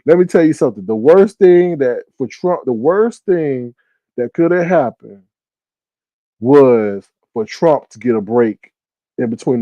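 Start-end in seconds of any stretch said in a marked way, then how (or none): 0.8-3.7 slow, weighty stress on worst; a deliberate slow warning; slow emphasis. Real, not acted. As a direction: lean on the worst thing slowly. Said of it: "slow emphatic point".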